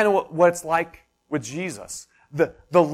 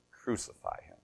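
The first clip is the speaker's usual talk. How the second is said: hushed crowd cry; 16 dB quieter than usual. Retold or imitated. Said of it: "and they yell".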